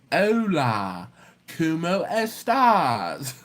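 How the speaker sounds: slow, stretched mock Spanish accent; hammed-up Spanish accent, long drawn syllables; exaggerated Spanish accent. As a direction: overdo the Spanish accent, dragging each vowel. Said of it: "I need to speak Spanish like this".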